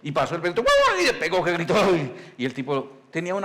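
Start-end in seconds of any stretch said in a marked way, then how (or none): 0.6-1.0 sudden high loud woof; yelped dog bark. Retold or imitated. Dog jumps out barking.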